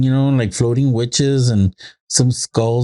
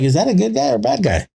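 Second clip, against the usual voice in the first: put-on much higher, curious child's question; imitated little girl; 10 semitones higher, faster than usual. Daughter asks dad about movie characters, imitated childlike question. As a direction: lift the voice into a small kid's asking.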